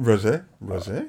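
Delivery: silly put-on voice for rosé; stupid voice. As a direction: ham up the word rosé. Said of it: "just in a stupid voice".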